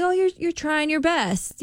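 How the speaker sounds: put-on high, slow and soothing, as if to a small child; gentle encouragement to a kid. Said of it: "I would tell that to, like, a kid".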